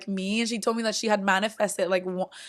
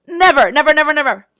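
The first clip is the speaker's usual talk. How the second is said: loud, higher, each never hit hard; emphatic repetition; 6 semitones higher, 10 dB louder, faster than usual. Real, not acted. Telling viewers they never once met, emphatic nevers. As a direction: hammer every never home.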